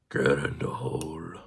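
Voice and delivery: whispered coaxing; threading a needle. Real, not acted. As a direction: breathe it, coaxing the part in. Coaxing part into hole.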